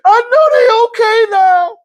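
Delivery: high, slow, tearful sobbing; imitating her crying it out in relief; boo-hooing. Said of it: "she just cried and boo-hooed".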